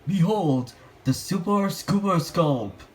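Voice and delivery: Jimmy unveiling his invention with a proud cry; grand presentation. A narrator's reading. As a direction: present it like a showman. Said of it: "cried Jimmy".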